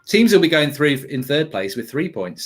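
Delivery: ordinary delivery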